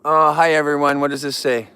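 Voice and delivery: normal talking voice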